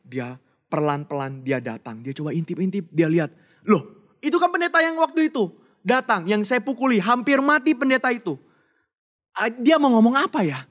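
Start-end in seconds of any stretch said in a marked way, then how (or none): 3.6-10.6 high and loud, astonished; startled recognition. Acted. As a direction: gasp in disbelief at who it is. Niki recognizes the beaten pastor.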